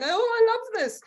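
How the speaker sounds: high, gleeful mock exclamation; retold laughing delight. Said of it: "and then I'm laughing".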